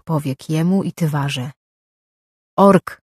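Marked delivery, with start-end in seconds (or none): 2.6-3.0 a loud call across the yard. A narrator's reading. Tywara calling Lorkin to the wagon, loud call. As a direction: call him over sharply. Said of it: "zawołała Tywara".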